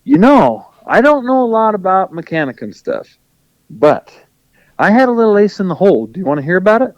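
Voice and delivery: lightly voiced helpful friend with a tip; Rooster's voice. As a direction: friend offering advice, lightly imitated. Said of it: "and he goes".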